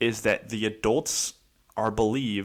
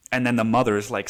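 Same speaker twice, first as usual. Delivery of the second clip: ordinary talk, nothing marked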